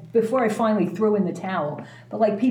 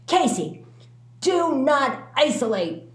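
put-on stern older lady; 5 semitones higher, slower than usual. Stern older lady orders her.

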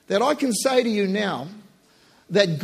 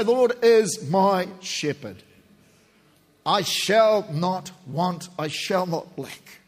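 slow prayerful recitation; 2 semitones lower, slower than usual. Real, not acted. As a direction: let each phrase settle slowly, reverently. Psalm spoken slowly in prayer.

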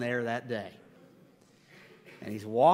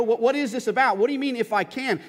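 high, loud, insistent questioning; acted indignant disbelief; indignant challenge; 7 semitones higher, 7 dB louder than usual. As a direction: demand an explanation. Acts Jesus challenging the father.

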